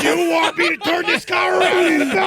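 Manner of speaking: high, yelling fed-up dad; dad on a road trip. Pretend. Father yelling at the doll in the back seat, exasperated shout. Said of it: "like a father absent-mindedly slapping at his noisy kids".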